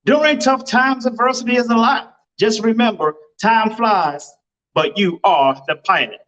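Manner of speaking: a lightly performed motivational rhyme; motivational recital. Acted. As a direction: deliver it like an inspiring poem. Recites motivational intro verse.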